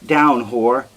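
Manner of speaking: loud barked order of contempt; a husband shoves his begging wife down, furious; brutal command. Acted. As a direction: force her down with your voice. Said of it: "and he says".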